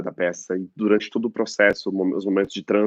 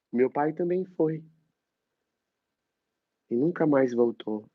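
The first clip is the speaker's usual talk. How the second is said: theatrical, wounded recital; dramatic monologue; 4 semitones higher than usual. Acted. Actor reciting his father's abuse, wounded. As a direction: let the hurt rise.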